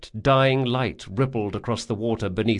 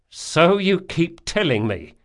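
weary sarcasm; 8 semitones higher than usual. Acted. Locke's weary retort.

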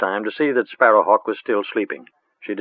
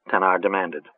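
plain reading voice

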